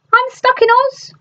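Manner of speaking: put-on very high, lightly sobbing girl; mock sob. A narrator's reading. Dorothy despairing before Glinda, high and tearful. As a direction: wail it up high, a touch tearful. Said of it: "Dorothy sobbed".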